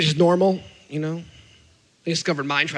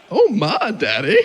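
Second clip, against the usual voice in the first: put-on toddler as a scandalized Southern granny; Southern elderly lady; 3 dB louder, slower than usual. Toddler shocked at Dad, Southern elderly lady accent. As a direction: swoop through it in a Southern old-lady accent. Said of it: "like he's a Southern elderly lady".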